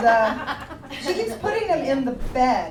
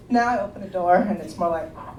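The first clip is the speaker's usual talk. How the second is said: normal talking voice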